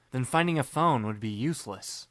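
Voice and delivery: ordinary delivery, nothing marked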